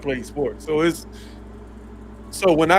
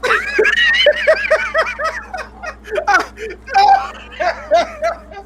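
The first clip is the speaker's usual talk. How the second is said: loud high laugh; 5 dB louder than usual. Real, not acted. Cracking up after fan story.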